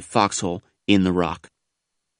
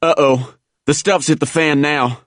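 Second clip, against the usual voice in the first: raised-pitch groan of dismay; mild groan; 6 semitones higher than usual. A narrator's reading. Buddy as mortar shells land, groaning mildly. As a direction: groan lightly that trouble has arrived. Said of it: "groaned one of my buddies".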